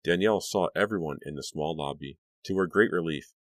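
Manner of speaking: usual speaking voice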